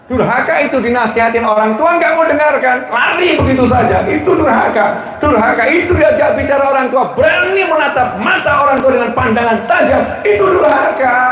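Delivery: high-pitched, forceful preacher's denunciation; full-force sermon crescendo; impassioned preaching. Real, not acted. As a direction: hammer each accusation home at a high pitch.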